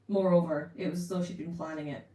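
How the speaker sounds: ordinary delivery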